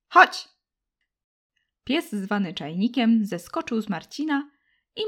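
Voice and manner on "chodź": loud high call; girl calling out. Acted. Girl calls her dog to come, loud and high. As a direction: shout the dog back to you. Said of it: "zawołał dziewczęcy głos".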